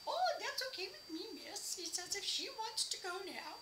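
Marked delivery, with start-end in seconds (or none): 0.1-1.7 high, lilting singsong; sing-songy voice. Retold or imitated. Man to lady at the register, sing-songy. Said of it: "he had this sing-songy voice". 2.1-3.6 high, lilting singsong; sing-songy voice. Retold or imitated. Man to lady at the register, sing-songy. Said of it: "he had this sing-songy voice".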